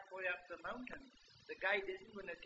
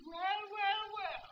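put-on old woman; 12 semitones higher, 4 dB louder, slower than usual. Old wife voiced in story.

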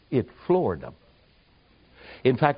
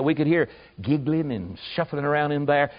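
ordinary talk

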